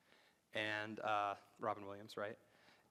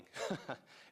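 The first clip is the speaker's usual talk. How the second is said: breathy laugh; 4 semitones higher than usual. Real, not acted. Laughs at own joke.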